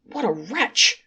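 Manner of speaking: a touch of shocked horror; horrified cry. Acted. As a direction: angels mildly cry out in horror at the sinner. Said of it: "cried the angels in horror".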